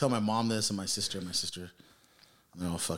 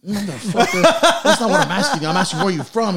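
louder, higher, half-laughing mock rage; mock angry cop; 10 semitones higher, 8 dB louder than usual. Cop yelling at Louis for dodging the question, mock angry shout. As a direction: imitate an exasperated cop yelling.

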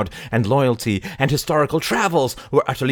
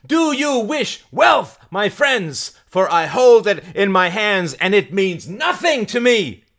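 high, raised preaching voice; Mary, fiery preacher; 10 semitones higher, 4 dB louder than usual. Acted. Mary tempting the crowd with wealth, high impassioned preaching. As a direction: exhort the crowd with pointed questions.

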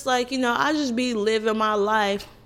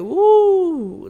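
loud, high mock woo of shock; a loud, high teasing woo; relatives' woo; 8 semitones higher, 8 dB louder than usual. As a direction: hoot it like a shocked aunt. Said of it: "they always like to start with the woo".

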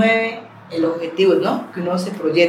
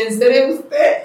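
a mock yell of disbelief, pitched higher and louder; retold excited shout; 7 semitones higher, 5 dB louder, slower than usual. On the phone with Andrea, thrilled disbelieving yell. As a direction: shriek it like you can't believe the call. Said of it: "yo gritaba".

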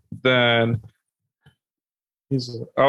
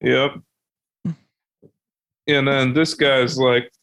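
usual speaking voice

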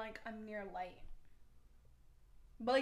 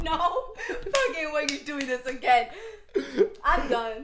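loud exasperated; 2 semitones higher, 10 dB louder than usual. Real, not acted.

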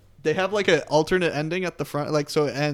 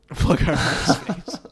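laughing repeat; 3 semitones higher, 4 dB louder, much slower than usual. Real, not acted. Mock-repeating a friend's joke.

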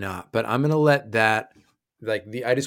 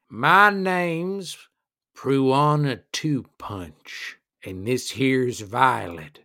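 tough dwarf woman; 2 semitones higher, much slower than usual. Acted. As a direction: introduce yourself slowly as a tough, angry dwarf woman. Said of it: "And she says".

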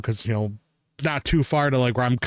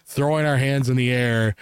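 ordinary delivery

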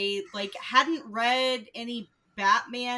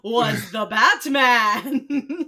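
dramatic narrator; 2 semitones higher, 11 dB louder, slower than usual. Acted. Dramatic reading of the reveal.

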